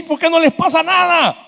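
rising, high-pitched complaint; indignant protest. Acted. Preacher voicing the congregation's complaint about unpunished people, indignant protest. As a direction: voice a frustrated believer's grievance.

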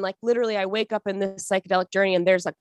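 normal talking voice

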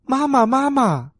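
put-on high childlike call; eager high cry after mama. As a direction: call out high, like little tadpoles. Tadpoles chase and call mother.

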